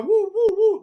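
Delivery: high woo woo siren sound; playful siren noises; imitated siren. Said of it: "mad cop car".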